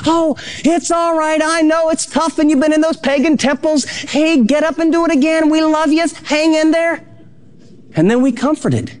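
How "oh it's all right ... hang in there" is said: high, lively encouraging father voice; cheering dad. Retold or imitated. A dad cheering on his son who keeps falling, with a lifted encouraging voice. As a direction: cheer your son back onto his feet. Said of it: "We were the dad who said".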